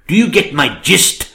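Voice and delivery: normal talking voice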